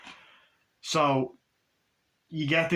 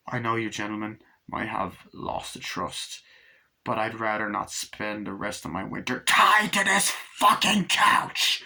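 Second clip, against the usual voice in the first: slow, controlled start building to a roar; measured politeness exploding into a furious mock shout; acted outburst; 2 semitones lower, slower than usual. Tied-up man addressing the gentlemen, acted building outburst.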